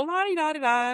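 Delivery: high, lilting la-di-da; carefree singsong. Acted. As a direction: trill it like a breezy, obedient child. Mimics child's carefree compliance.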